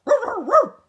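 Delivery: high, loud barks; hammed-up barking; dog barking. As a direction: bark like a startled dog. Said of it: "who barked".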